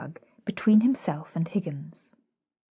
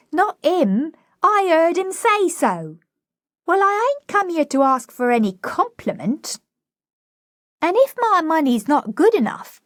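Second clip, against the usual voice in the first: put-on high, indignant cockney girl; haughty protest, pitched high; proud flower girl; 10 semitones higher, 4 dB louder than usual. Flower girl offended at the housekeeper, indignant.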